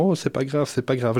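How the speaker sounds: ordinary talk, nothing marked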